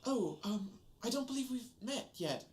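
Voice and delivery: a hint of a flustered servant girl. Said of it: "And she says".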